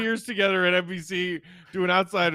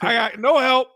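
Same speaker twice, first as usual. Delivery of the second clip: louder, higher fed-up grumble; pitched-up exasperated imitation; mock frustrated complaint; 7 semitones higher, 6 dB louder than usual. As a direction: complain like a star carrying the team alone. Retold frustrated player complaining.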